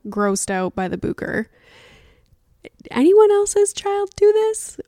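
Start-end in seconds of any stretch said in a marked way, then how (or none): 2.9-4.8 high, loud disbelieving question; incredulous. Real, not acted. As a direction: pitch it way up in disbelief. Asking viewers, exasperated.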